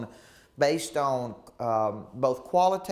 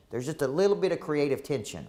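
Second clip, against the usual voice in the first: ordinary talk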